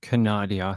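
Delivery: "Canada" said as ka-NAH-dee-a; Canada like Narnia. Pretend. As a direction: stretch "Canada" to four syllables, ka-NAH-dee-a. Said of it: "sounds like the Canadian version of Narnia".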